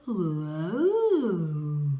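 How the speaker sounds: goofy wobbling oh; silly voice. Shown as a demonstration. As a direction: play it daft and funny. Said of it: "say it in a silly voice".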